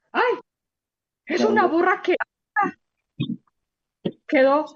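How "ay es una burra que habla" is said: higher, amazed exclamation; astonished. Acted. Bilam marvels at the donkey, higher amazed. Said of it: "Así dijo".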